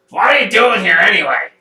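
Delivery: loud, fast parrot voice; parrot character. Acted. Wizard stuck as parrot answers Perrin, loud and hurried. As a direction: rattle it out as an irritable bird. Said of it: "Don't mind his voice".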